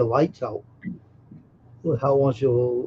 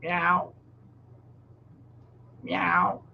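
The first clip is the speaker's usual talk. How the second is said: soft meow; cat meow; 4 semitones higher, 4 dB quieter than usual. Pretend. Imitating cat.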